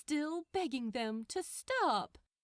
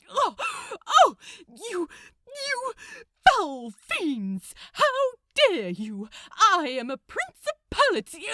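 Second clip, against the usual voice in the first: louder, sputtering indignant angel; flustered outrage; 10 semitones higher, 13 dB louder than usual. Acted. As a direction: splutter like a bullied schoolkid trying to stand up. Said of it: "sounding like a bullied school kid".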